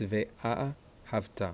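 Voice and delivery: "ve'a אהבת" broken up, weight on the A and final ta; stress by accent marks. Shown as a demonstration. Teacher correcting ve'ahavta for students, accented syllables separated. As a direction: say "ve'a אהבת" in parts, leaning on the marked syllables. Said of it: "this is an accent mark".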